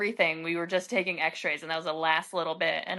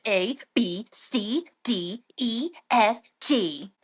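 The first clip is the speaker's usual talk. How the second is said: obnoxious spelling; 3 semitones higher, much slower than usual. Retold or imitated. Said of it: "in the obnoxious".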